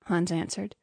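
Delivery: ordinary delivery, nothing marked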